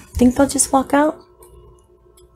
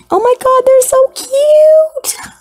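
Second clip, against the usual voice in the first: very high, slow squeal of delight; high, drawn-out, near-crying squeal of adoration; squealing gush; 11 semitones higher, slower than usual. Real, not acted. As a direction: melt into a squeal over them. Gushing over isopods.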